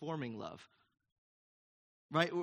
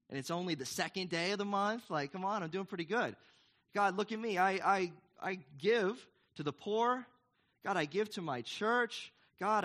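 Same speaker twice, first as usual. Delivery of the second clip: whiny, higher braggart begging God to notice him; boastful self-praise to God; 3 semitones higher than usual. Pretend. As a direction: show off to God like a needy kid, building to a shout. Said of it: "I can't boast and say".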